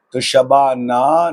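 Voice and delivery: شبانہ said slowly, ending on an open a, no h; slow clear demo. Shown as a demonstration. Teacher slowly modelling شبانہ for learners. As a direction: stretch out شبانہ, final ہ silent. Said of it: "ایچ نہیں لگائیں گے".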